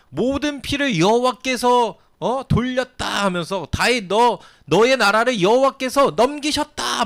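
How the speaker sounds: high, loud, slow curse; cursing shout. Retold or imitated. Shimei shouting curses at David, high and loud. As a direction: hurl the curse in God's name. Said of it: "막 이러면서".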